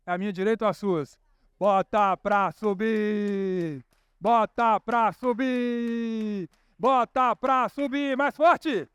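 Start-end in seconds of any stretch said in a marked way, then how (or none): 1.6-8.9 slow chant, rising higher each time; roller coaster climb. Pretend. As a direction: build the climb step by step. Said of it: "Vamos na montanha-russa".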